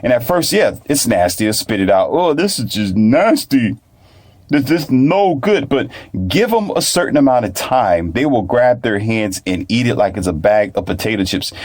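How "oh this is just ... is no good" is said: drawn-out, higher-pitched mock revulsion; imitated disgust. Child spitting out new food, imitated disgust. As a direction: mimic a child rejecting a nasty taste.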